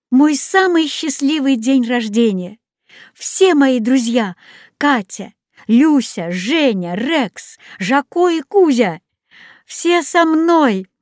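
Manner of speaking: rising happy outburst; joyful. A narrator's reading. Boy overjoyed his friends are with him, excited cry. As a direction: burst out with birthday joy.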